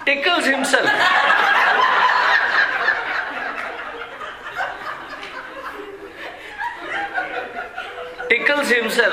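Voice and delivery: laughing hard, high; long high laugh. Real, not acted. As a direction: break into helpless laughter. Laughing at the kid's prank.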